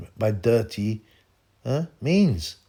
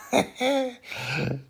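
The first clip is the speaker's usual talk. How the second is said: high laugh; 13 semitones higher, 4 dB quieter than usual. Real, not acted. Laughs after quote.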